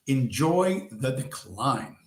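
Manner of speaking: half the speed, drawn out; slow catchphrase. Real, not acted. Signing off a rant to his viewers, slowly. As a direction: savour every word.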